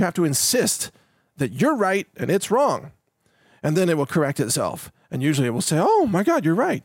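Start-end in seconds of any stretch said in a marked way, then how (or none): 5.8-6.8 high-pitched 'oh my God' of sudden discovery; mock realization. AI engine realizing its error, mock astonished. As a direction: play up being suddenly corrected.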